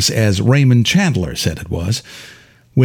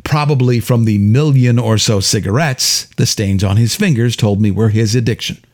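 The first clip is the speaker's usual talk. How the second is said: usual speaking voice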